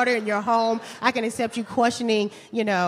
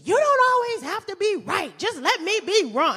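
put-on whiny boyfriend; 11 semitones higher than usual. Boyfriend quoted whining.

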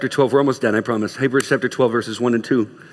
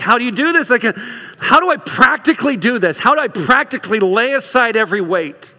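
exasperated questioning; 8 semitones higher, 3 dB louder than usual. Acted. Voices a frustrated believer's question.

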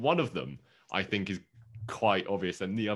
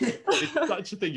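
laughing; 3 dB louder than usual. Real, not acted. Laughing after the joke.

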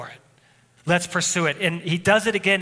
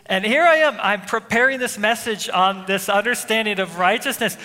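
louder, higher heated retelling of the outburst; retold anger; 3 semitones higher, 4 dB louder than usual. Pastor retelling yanking his son's radio, heated and loud. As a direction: relive the angry rush, pushing the volume up. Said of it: "I was so angry".

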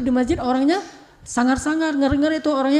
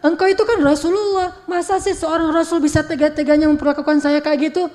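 retold angry protest, pitched up; indignant Aisyah; 4 semitones higher, 3 dB louder than usual. Wife protests at how she is treated, indignant. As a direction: reproach him like a wronged wife. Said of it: "marah Aisyah".

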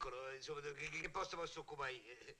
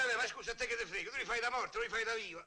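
heated retort; 6 semitones higher, 4 dB louder than usual. Acted. Retorts in comic argument.

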